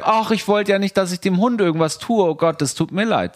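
high, lightly voiced apologetic woman; playing the flustered stranger, sorry and eager.

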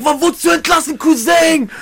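high sing-song excited greeting. Retold or imitated. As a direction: greet him, high and thrilled.

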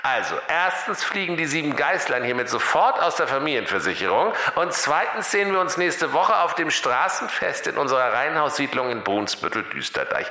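high radio-spot voice, falling away towards the end; Kaiser on the radio. Acted. Kaiser broadcasts his advert to neighbours, high radio voice. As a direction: announce pompously over a thin radio speaker. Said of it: "da hörten sie den König Kaiser sagen".

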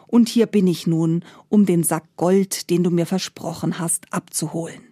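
usual speaking voice

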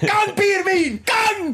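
high, excited cheering; excited shout. Real, not acted. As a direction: cheer them on wildly. Excited over a result.

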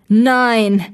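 loud, drawn-out cry of protest; thwarted villain crying out; dismayed cry. A narrator's reading. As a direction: call out in dismay. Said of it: "rief er".

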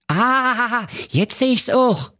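very high, flustered comic king; a panicking king shouting orders; king losing his head over his missing daughter, high and agitated; flustered King Pilsner. Acted. King agrees.

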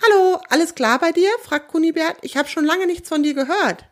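lightly voiced friend over the radio. A narrator's reading.

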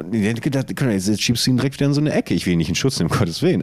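ordinary delivery

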